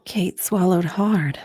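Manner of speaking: usual speaking voice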